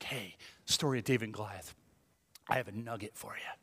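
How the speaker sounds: low, very soft, over-dramatic imitation; mock dramatic hush. Pastor on podcast offering a nugget, dramatically hushed. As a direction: confide it like a great secret, hammed up. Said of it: "all dramatic".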